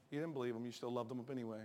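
ordinary delivery

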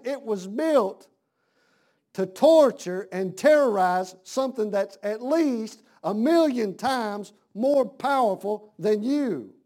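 slow, emphatic preaching. Acted. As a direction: lean on every word, slowly. Preacher driving home the point.

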